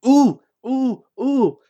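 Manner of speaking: high, loud eager ooh-ooh-ooh; a child waving a hand in class, squealing; excited squeals. A narrator's reading. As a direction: bounce like a kid begging to be picked. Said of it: "Pinky bounced excitedly".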